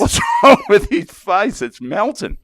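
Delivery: high incredulous laughing question; laughing bewildered voice; mock bewildered. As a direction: ask it up high, spooked and amused. Imitating confused band members.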